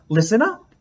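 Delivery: put-on bossy little-girl teacher call; playing teacher to baby brother; mock teacher command. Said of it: "Lily pretended to be Mister Slinger".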